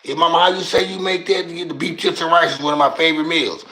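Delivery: usual speaking voice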